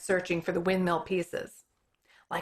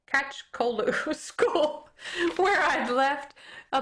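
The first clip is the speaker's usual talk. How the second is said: slow words with real laughter breaking in; laughing through it; 7 semitones higher, slower than usual. Real, not acted. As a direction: giggle through the tricky name. Reader laughs at hard name.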